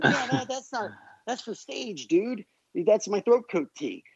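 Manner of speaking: high, offhand rock-star shrug; casual brush-off. Retold or imitated. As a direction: brush it off like it's nothing, mate. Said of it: "And he goes".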